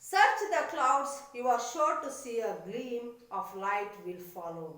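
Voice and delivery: slow, loud opening of a recited verse; teacher reading a poem to the class. Acted. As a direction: recite the lines with emphasis. Reads poem lines.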